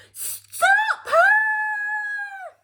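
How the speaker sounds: very high yelled alarm; frantic guard cry; shout. Acted. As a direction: bellow to stop the runaway. Guards chase escaping Queen.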